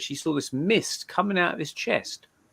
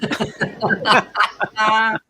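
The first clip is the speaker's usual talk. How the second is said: a real burst of laughter at her own joke; hearty laugh; 10 semitones higher, 7 dB louder than usual. Real, not acted. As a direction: laugh out loud, amused. Laughs at own joke about death.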